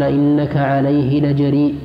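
ordinary talk, nothing marked

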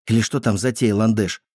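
ordinary delivery, nothing marked

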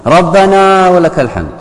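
high, loud and slow chorus; mock loud congregation. Mocking worshippers who shout their response. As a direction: belt it out like the whole crowd. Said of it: "شوف صوت الناس".

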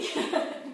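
a quick laugh breathed out with no voice; short silent laugh. Real, not acted. Laughing at herself while telling the church ladies. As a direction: laugh it off under your breath.

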